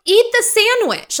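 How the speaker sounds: high, loud, fed-up yell; exasperated shout. Retold or imitated. Comedian yells at influencer posing with sandwich, loud and high. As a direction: yell it at her like she's ridiculous. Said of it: "I'm like".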